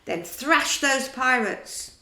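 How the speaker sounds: slow, loud battle command; rally the troops to fight. A narrator's reading. Peter rallies the boys to attack, slow and loud.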